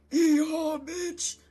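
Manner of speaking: high, loud, whooping battle cry; shouted magic word. Acted. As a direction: holler it out like a cowboy's rallying cry. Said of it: "I'm going to shout".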